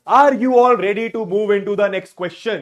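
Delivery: loud, drawn-out host call; rally the room like a game show host; announcer hype. Acted. Teacher rousing online class before next question.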